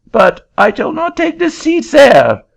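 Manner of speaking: put-on very high, loud French-accented protest; an indignant Frenchman in a shrill-high French accent; angry French accent. Frenchman refusing the auctioneer's seat, high loud French accent.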